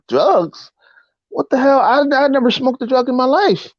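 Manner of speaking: high-pitched, frightened protest; panicked disbelief. Retold or imitated. Nineteen-year-old to police who want drugs, panicked pleading. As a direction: retell pleading innocence to the cops, pitch up. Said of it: "I, I'm like".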